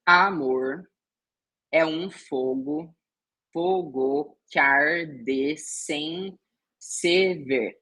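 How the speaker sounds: verse drawn out syllable by syllable, slow; teacher scanning a verse aloud, slowly; slow scansion. Shown as a demonstration. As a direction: stretch each syllable to count the meter. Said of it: "a metrificação ou a escansão deste verso aqui".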